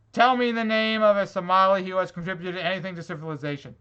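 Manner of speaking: ordinary talk, nothing marked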